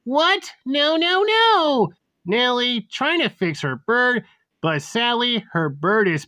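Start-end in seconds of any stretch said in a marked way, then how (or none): 0.0-1.9 high-pitched shocked cry of disbelief. Acted. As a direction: climb higher into a distressed "no". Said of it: "Nellie was shocked and asking herself".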